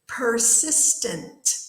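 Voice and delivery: "persistent" slowed into clear, separate syllables; model pronunciation. Shown as a demonstration. Teacher introduces the next word to students, slow careful pronunciation. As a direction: say "persistent" slowly for learners to copy. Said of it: "I'm gonna say the word".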